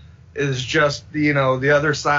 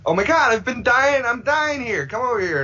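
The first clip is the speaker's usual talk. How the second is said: overjoyed; 8 semitones higher than usual. Retold or imitated. Retold joyful reunion cry.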